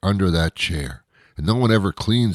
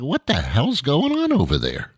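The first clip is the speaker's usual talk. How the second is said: much higher, mock-incredulous question; mock foreigner's exasperation; 7 semitones higher than usual. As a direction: ham up a puzzled foreigner asking. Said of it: "it's like".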